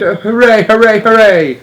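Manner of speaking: high, loud gleeful cheer; excited cheer. Acted. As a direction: cheer like a thrilled child. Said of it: "I cried".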